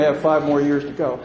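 ordinary talk

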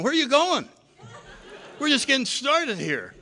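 high, loud laughing plea to stay; excited protest. Retold or imitated. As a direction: beg the party to keep going, half laughing. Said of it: "I'm going".

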